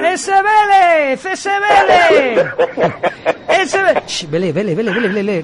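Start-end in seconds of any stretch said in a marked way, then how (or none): 0.0-2.4 excited cheer. Acted. Cheering the song on air.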